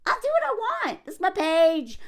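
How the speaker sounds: high-pitched defiant retort; bratty, high-pitched defiance. Real, not acted. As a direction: push back in a high voice. Defiant answer to tone policing.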